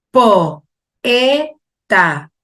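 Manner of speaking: "Po, e, ta" in three detached beats; syllable by syllable. Shown as a demonstration. Teacher reading written examples aloud, "Po, e, ta" split apart. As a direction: say "Po, e, ta" with a gap after each syllable. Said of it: "analizando oralmente".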